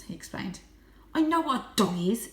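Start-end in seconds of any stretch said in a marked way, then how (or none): 1.1-2.3 snapped. A narrator's reading. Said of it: "she snapped".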